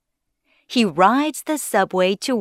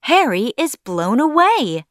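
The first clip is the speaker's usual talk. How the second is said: ordinary delivery, nothing marked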